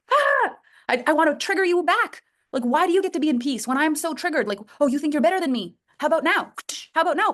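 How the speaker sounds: high, worked-up needling; triggered provocation. Acted. Triggered person wanting to trigger you back, needling.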